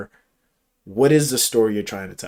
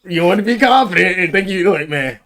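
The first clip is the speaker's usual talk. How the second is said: hammed-up coach voice; 8 semitones higher, 8 dB louder than usual. Mock coaching in put-on voice.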